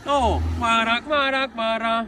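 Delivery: loud, high calls to a passing car; calling out Kvara. Real, not acted. Fan shouting at Kvara's car as it passes. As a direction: yell his name to get him to stop.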